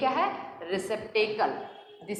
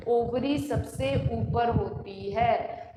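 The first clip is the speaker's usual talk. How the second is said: "Ovary सबसे ऊपर होती है" said slowly and deliberately; slow emphatic repeat; much slower than usual. Shown as a demonstration. Teacher repeating the key fact to students, slow and deliberate. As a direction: drive the point home slowly.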